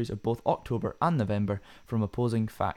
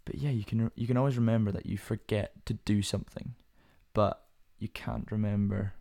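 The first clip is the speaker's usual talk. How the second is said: ordinary delivery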